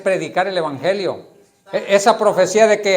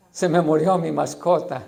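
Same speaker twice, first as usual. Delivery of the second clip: mock weeping owner. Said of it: "están llorando".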